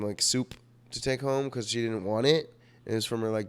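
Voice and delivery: normal talking voice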